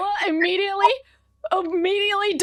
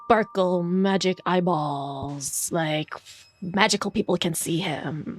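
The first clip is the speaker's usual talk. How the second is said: very low, slow, drawn-out words; mock-mystical drone; 10 semitones lower, slower than usual. Player explains magical sight to a friend, mock-mystical and low.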